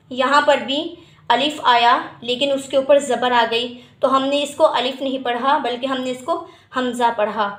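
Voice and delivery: ordinary talk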